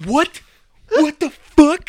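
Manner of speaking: high, drawn-out mock bafflement; baffled reaction. Retelling his reaction to the Utah girl, exaggerated bafflement. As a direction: act out disbelief, pitch way up. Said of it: "I was like".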